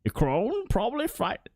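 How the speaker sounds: high cackling witch voice. Acted. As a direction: play an old crone witch. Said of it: "so I have to do the witch voice".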